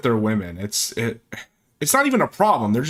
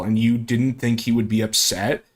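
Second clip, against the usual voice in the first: ordinary talk, nothing marked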